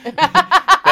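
loud high squeal of a laugh; helpless high laugh; high burst of laughter. Real, not acted.

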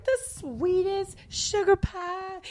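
drawn-out sugar-pie sweetness; sugary sweet. Retold or imitated. Describing the sweetest Dallas agents, slow and sugary.

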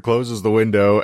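usual speaking voice

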